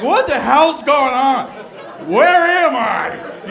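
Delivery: loud, slow, bewildered outburst; bewildered shout. Retold or imitated. Husband overwhelmed by the switch, loud slow bewilderment. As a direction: yell it in baffled disbelief. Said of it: "I was just like".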